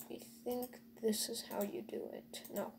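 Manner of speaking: soft unvoiced whisper. Real, not acted. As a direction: whisper it to yourself. Quiet aside while crafting.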